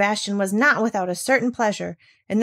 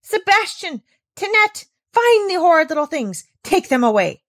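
loud, higher commands; panicked calling out; 11 semitones higher, 7 dB louder than usual. Acted. Governess calling the servants to remove kittens, loud. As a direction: shout for the servants.

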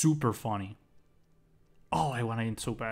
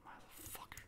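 breathy whisper of a swear; a real whispered curse in defeat; 26 dB quieter than usual. Real, not acted. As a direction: hiss it under your breath. Muttered curse after losing.

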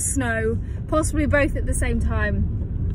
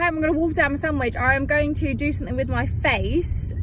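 normal talking voice

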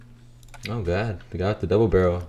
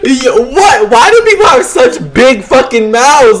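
taunting; 13 semitones higher, 8 dB louder than usual. Real, not acted.

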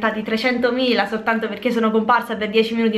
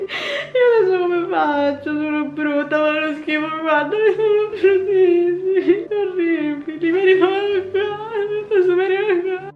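hammed-up weepy whine, much higher; fake crying; 7 semitones higher than usual. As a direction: sob over the lost hair. Crying over cut hair.

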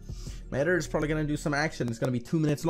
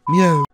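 loud meow; 5 semitones higher, 14 dB louder than usual. Pretend. Repeated meow sound drop.

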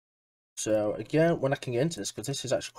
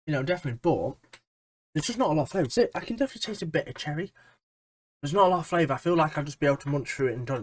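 chewing between words; talking while chewing; 2 semitones higher, faster than usual. Real, not acted. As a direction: mouth partly full of candy. Seen 0: Hand to mouth at -9; jaw works between words, taffy held.

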